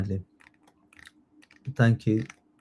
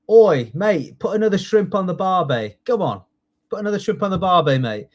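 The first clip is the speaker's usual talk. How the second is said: mock Australian accent; 5 semitones higher than usual. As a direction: play a cheerful cartoon Australian. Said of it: "the Australians say things like".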